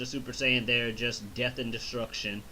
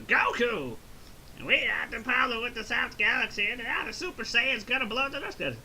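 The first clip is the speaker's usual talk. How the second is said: King Kai impression; 9 semitones higher than usual. Recapping the movie, King Kai calling Goku in a high cartoon voice. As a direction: play the excitable little god shouting news. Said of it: "King Kai impression is pretty fucking good".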